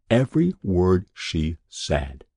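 ordinary delivery

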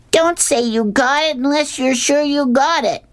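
very high-pitched scolding call; mock yell. A narrator's reading. Boy on the mound warning the fielder, mock yell.

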